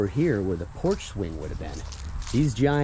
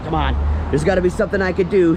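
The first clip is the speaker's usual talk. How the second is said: quick, higher, louder imitated plea; mock pleading; 7 semitones higher, 5 dB louder, faster than usual. Vlogger as tenant bargaining with fuming landlady, mock pleading. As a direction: rush it out like someone bargaining.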